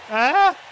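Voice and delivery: loud, high pained cry; anguished yell. Real, not acted. Game forcing a grim defeat.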